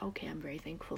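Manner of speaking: soft, hushed whisper of gratitude; whispered thanks. Retold or imitated. Seeing where her parents grew up, a quiet thankful whisper. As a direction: say it quietly, as if to yourself.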